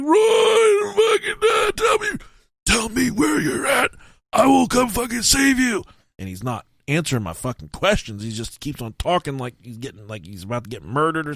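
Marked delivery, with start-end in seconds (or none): 0.0-5.8 very high, loud, near-crying yell; frantic shout. Retold or imitated. Frantic friend yelling into the phone, hammed-up panic. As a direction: beg him to say where he is.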